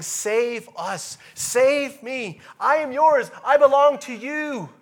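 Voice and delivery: loud, high pleading cry; desperate cry. Acted. Preacher voicing the psalmist crying to God, loud and pleading. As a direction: cry out to be saved. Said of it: "the psalmist is saying".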